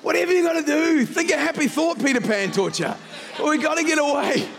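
high, urgent, sustained sermon delivery; impassioned preaching. Real, not acted. Pastor warning that the devil trades in darkness, impassioned preaching. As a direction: drive the point home to the congregation. Exhorts congregation.

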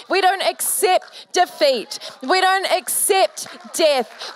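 rising triumphant proclamation; preaching at full force. Acted. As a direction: build it like a sermon peak. Sermon climax.

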